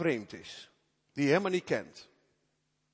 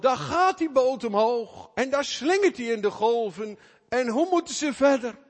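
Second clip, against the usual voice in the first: panicked cry; 8 semitones higher, 7 dB louder than usual. Acted. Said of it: "schreeuwen in doodsnood".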